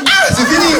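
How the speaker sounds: mock defeated shout. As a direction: throw up your hands and yell it's over. (crier faussement vaincu)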